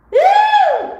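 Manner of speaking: very high, loud whoop; joyful whoop. Real, not acted. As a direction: let out a whoop of pure joy. Joyful outburst.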